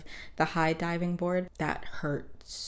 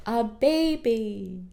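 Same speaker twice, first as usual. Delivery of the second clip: put-on high, slow stunned "a baby"; dismayed disbelief; 6 semitones higher, 3 dB louder, much slower than usual. Arthur hearing about the new baby, slow stunned disbelief. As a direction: let it sink in slowly, high and unbelieving. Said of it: "Arthur is like".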